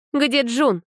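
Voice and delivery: put-on high, firm young woman's question; firm demand. A narrator's reading. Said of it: "твёрдым голосом спросила".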